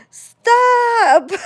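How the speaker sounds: high, loud frantic yell with a laugh; desperate shout. Retold or imitated. Babysitter yelling at the unruly kid, frantic high shout. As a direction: scream it, half laughing, at your wits' end. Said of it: "I was going crazy".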